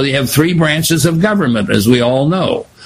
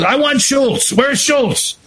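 high, demanding cartoon king calling out; high-pitched grumpy king in a comic strip; 10 semitones higher than usual. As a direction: whine for Schultz like a spoiled cartoon king. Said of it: "He says".

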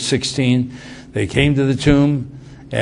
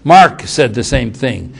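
ordinary talk

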